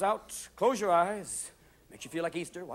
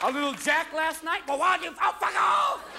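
rising to a high, loud snap; hungover snapping; 7 semitones higher, 3 dB louder than usual. Retold or imitated. Hungover worker snaps at a friend, building to a loud high shout. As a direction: start slow, then blow up at them.

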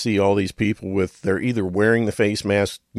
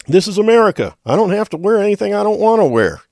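much higher, louder mock outrage; hammed-up indignant yell; defiant shout; 13 semitones higher, 8 dB louder than usual. Kramer yelling back at the crowd, much higher and louder.

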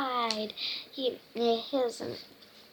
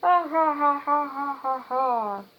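rolling mock guffaw, falling away; acted laughter that ebbs like a wave; laughing waves; 2 semitones higher, 3 dB louder than usual. A narrator's reading. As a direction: roll out a long storybook "har, har". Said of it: "even the waves laughed and laughed".

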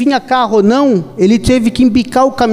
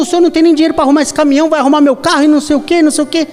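high-pitched, fast mock outrage from a furious driver; indignant mock rant; 5 semitones higher, faster than usual. Angry Ferrari driver retold.

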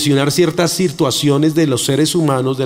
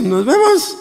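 breezy goodbye; 12 semitones higher, slower than usual. Retold or imitated. Casual goodbye.

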